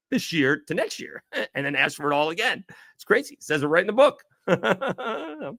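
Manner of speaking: high, laughing disbelief; giggling, pitch jumping up high. Real, not acted. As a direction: laugh through the words at the absurdity. Joking about atonement rules.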